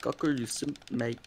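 put-on Canadian accent, fairly soft. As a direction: tease in a lightly done Canadian accent. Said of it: "That was my Canadian accent".

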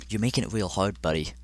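usual speaking voice

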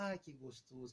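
put-on contented baby sigh. Bebê sente o talquinho. (suspiro satisfeito de bebê, bem baixinho)

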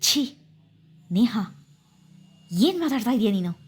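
mild disgusted rebuke, sharp Chhee; a hint of revolted disbelief. A narrator's reading. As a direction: recoil in disgust at her words. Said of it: "ಅಸಹ್ಯವಾಗಿತ್ತು".

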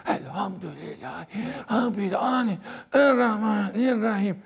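put-on elderly man reciting loudly. Preacher imitates old man shouting Fatiha beside you. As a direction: bellow it like a deaf old man at prayer. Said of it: "aşkından bağırıyor".

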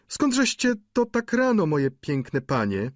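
high singsong woman's voice; landlady's greeting. A narrator's reading. Landlady greeting ladies coming in, high voice. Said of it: "spytała pani Wauquez".

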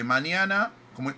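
ordinary delivery

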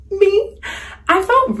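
high, unvoiced breathless gasp; can't believe she meant you; awed disbelief. Real, not acted. A fan gasping at Kristen Bell's compliment in awe.

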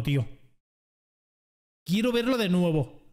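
ordinary delivery

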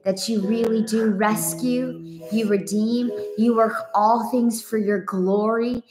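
ordinary talk

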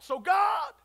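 high, drawn-out lead-in; call-and-response cue. Shown as a demonstration. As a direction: call it out for the congregation to answer. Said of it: "Let's try it".

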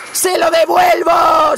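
real victory shout; triumphant shout. Real, not acted. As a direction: roar it as you strike back.

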